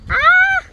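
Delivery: high, loud "ah", held on one note; imitated night cry. Friend mimicking Nayeli's night outbursts, high loud imitated cry. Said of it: "bien que feo le hacía".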